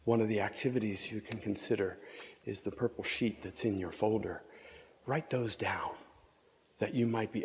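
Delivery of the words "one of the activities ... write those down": usual speaking voice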